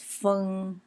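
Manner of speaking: feng held long and level; first tone. Shown as a demonstration. Teacher showing how hummingbird is pronounced, feng in first tone. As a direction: stretch feng out on an even first tone. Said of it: "in the first tone".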